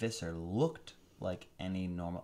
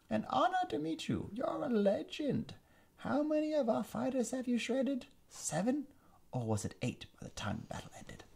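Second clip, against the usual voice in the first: a hint of British in the high villain voice; mock British villain. Visser mocking the Andalite prince, slipping into British. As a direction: let a British lilt creep in. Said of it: "I got British at the end".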